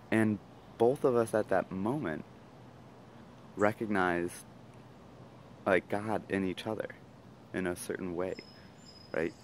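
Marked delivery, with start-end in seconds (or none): none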